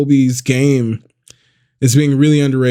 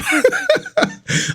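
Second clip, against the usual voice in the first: high giggle; 4 dB quieter than usual. Real, not acted. Laughs at own stumbling.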